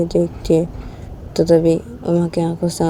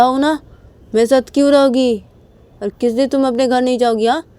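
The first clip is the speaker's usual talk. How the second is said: raised, higher-pitched demanding questions; hurt, challenging quarrel voice; upset confrontation; 6 semitones higher than usual. Acted. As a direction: press her for an answer, voice lifted. Acted quarrel, Sanju confronting Uma.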